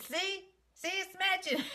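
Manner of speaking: very high-pitched playful imitation; mimicking the makeup girl. Vlogger mimics a girl's neck check, high mimicry. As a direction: pitch it way up, breaking into laughter. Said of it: "And she says".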